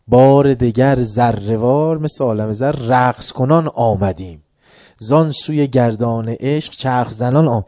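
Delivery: slow, drawn-out recited verse; poem recitation. Shown as a demonstration. Teacher reciting Rumi's verse to students, slowly. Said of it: "میگه اون بلا رو ما با رقص گفتیم".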